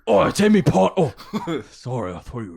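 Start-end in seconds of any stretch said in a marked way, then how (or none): 0.1-1.1 stoned friend. Pretend.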